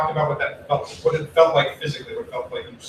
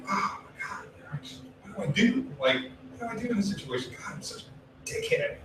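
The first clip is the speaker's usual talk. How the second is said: panicked whisper; 9 semitones higher, 10 dB quieter than usual. Retold or imitated. Panicked inner monologue quoted.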